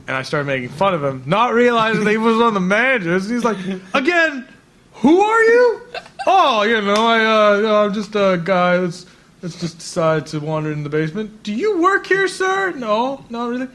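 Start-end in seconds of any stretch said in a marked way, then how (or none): 3.9-5.8 high, sharp challenge from an annoyed manager; mock stern manager. Manager catching him in basement. 6.3-11.4 sheepish laughing excuse. Retold or imitated. Sheepishly answering the manager. 11.4-13.7 high, squeaky questioning voice; mock manager.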